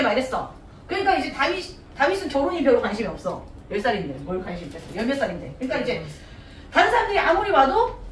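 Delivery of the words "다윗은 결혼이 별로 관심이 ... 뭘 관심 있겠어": normal talking voice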